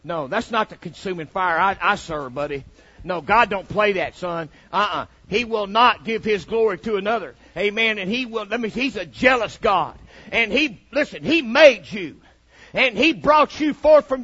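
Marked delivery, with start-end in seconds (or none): none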